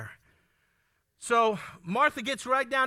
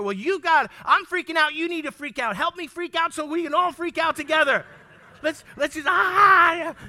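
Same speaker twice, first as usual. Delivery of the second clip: loud, higher, frantic mock freak-out; mock panicked husband; 5 semitones higher, 7 dB louder than usual. Husband demanding his wife freak out with him, hammed-up panic. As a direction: whip yourself into a hammed-up panic. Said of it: "If I expect her".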